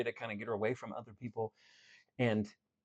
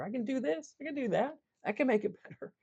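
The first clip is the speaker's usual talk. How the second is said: much higher, rising with new confidence; hopeful, excited; 11 semitones higher than usual. Pretend. Speaker voicing someone finding their power, excited. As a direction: lift it up as if hope just came back.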